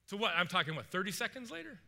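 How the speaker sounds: usual speaking voice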